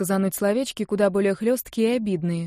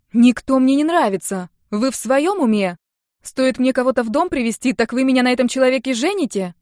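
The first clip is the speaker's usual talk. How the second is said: loud, higher-pitched exasperation; indignant protest; 5 semitones higher, 6 dB louder than usual. A narrator's reading. Niece protests to teasing uncle and sister, indignant. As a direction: push back hard at the teasing.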